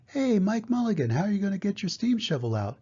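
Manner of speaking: high-pitched crowd calling down. A narrator's reading. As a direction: call to someone down a hole, voice lifted high. Crowd calls down to Mike.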